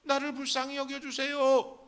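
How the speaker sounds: high-pitched pleading shout; desperate cry. Acted. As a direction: cry out for mercy. 바디메오의 부르짖음 연기. (애절하게 외치는 높은 목소리)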